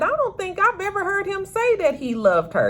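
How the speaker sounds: sassy correction; incredulous retort. Real, not acted. Vlogger correcting Tracy about his love, high and incredulous.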